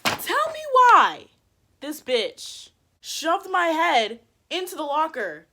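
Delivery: high, loud, drawn-out indignation; loud, incredulous build-up, easing down slightly; outraged storytelling. Retold or imitated. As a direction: slowly belt out the outrage. Outraged punchline of the shove.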